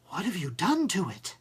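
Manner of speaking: breathless. A narrator's reading. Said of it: "said breathlessly".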